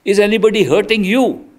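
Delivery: urgent question. Retold or imitated. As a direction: ask it urgently, up and loud.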